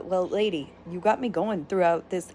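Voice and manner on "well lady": ordinary talk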